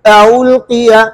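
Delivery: अउल्किया with the second hamza eased toward waw; tas'heel demo. Shown as a demonstration. Teacher models अउल्किया for students, careful recitation. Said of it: "अउल्किया में दूसरे हमजे में तस्हील कर".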